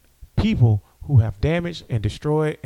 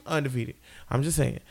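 usual speaking voice

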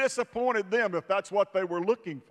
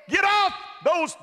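loud, higher exhortation, last words slowed; preaching shout; 8 semitones higher, 3 dB louder, slower than usual. Acted. Preacher to congregation about grave clothes, loud slowed shout. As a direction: thunder it at the congregation, drawing out the end.

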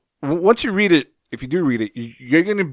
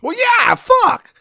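high-pitched, loud blurt; exclaiming; 4 dB louder, slower than usual. Real, not acted. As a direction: burst in with a high, emphatic yell. Blurted exclamation cutting into the story.

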